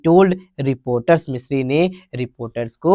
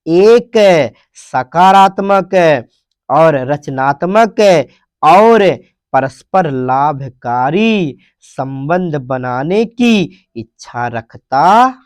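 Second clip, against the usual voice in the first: slow explaining; slower than usual. Shown as a demonstration. Teacher gives Hindi translation slowly.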